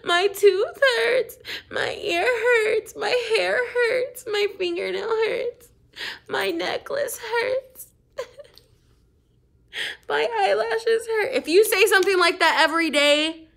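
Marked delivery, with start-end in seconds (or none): 0.1-11.3 high, whiny complaints of pain; whiny faked illness. Child fakes sickness to parents, high whining complaints. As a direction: whine like a kid faking sick. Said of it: "If you're like".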